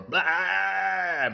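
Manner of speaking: long, loud, drawn-out "blaaah"; stretched-out silly nonsense sound; made-up noise. Pretend. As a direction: blurt a nonsense bleat, held high. Invents an arbitrary word sound.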